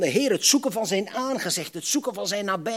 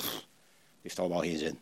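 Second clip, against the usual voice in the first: weary resignation; 14 semitones lower, 10 dB quieter than usual. Retold or imitated. Disciples tempted to give up.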